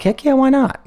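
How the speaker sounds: high, excited squeal of yes; eager yes. Retold or imitated. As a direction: jump at the offer with a squeal.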